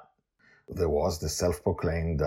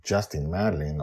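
usual speaking voice

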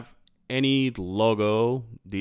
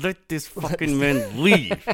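exasperated mock plea; 4 semitones higher, 3 dB louder, slower than usual. Voices the look given to stepdaughter.

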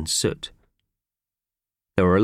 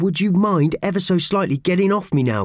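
strained politeness; 11 semitones higher, 4 dB louder than usual. Acted. Squashed friend politely protesting.

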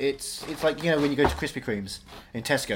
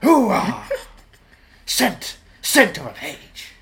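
loud Pacino bark; Al Pacino hoo-ah; 5 semitones higher, 7 dB louder, much slower than usual. Pretend. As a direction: shout it like Pacino. Scent of a Woman quote.

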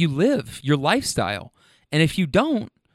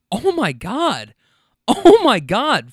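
slow, drawn-out oh my God, rising much higher and louder; stunned disbelief; 7 semitones higher, 5 dB louder, slower than usual. Retold or imitated. Host hearing the caller's story, stunned and drawn out. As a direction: gasp it out like you can't believe your ears.

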